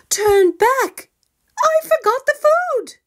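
high, loud alarmed cry; cried out. Acted. Mother bear remembering the food, crying out high and loud. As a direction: shout it out in a sudden panic. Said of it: "cried out".

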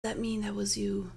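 very quiet, low murmur; near-whispered, low question; hushed aside. Real, not acted. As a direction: ask it almost under your breath.

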